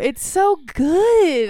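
slow, drawn-out gushing. Real, not acted. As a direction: savor every word.

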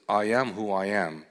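voice of God. Acted. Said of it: "And God said to Moses".

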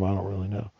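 ordinary delivery

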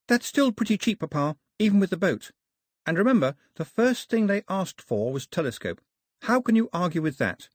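put-on high-pitched girl reasoning; persuasive daughter. A narrator's reading. Daphne persuading her papa about the gift, reasoning.

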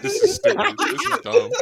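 real laughter through the words; laughing. Real, not acted. Laughing, high and loud.

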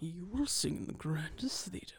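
hushed menacing whisper; whispered threat. Retold or imitated. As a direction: breathe the threat quietly.

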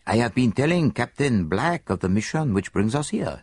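lightly voiced Belgian detective; a hint of a fussy foreign sleuth; Poirot. Detective tells his friend where they will stay, lightly voiced.

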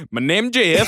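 meme voice. Retold or imitated. Co-host quoting the My name Jeff meme, dopey. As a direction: deliver the meme line dumb and flat. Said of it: "and go".

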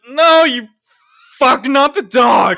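put-on drunken shout. Co-host re-enacts yelling drunkenly at the movie, in a loud shout. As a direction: yell at the screen like a drunk fan. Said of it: "that was me in a drunken state".

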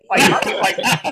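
breathless laughter swallowing the words; laughing. Real, not acted. Still teasing his friend, helpless with laughter. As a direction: laugh so hard the words break up.

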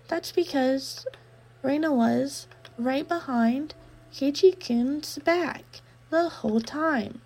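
slow, high, unsettling sweetness; creepy girl voice. Acted. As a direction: linger on each word like a stalker.

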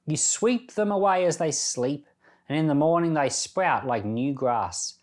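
ordinary talk

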